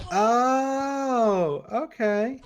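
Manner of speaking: high, loud oh, okay of sudden understanding; sudden penny-drop surprise; surprised realization. Real, not acted. Finally getting the name, surprised.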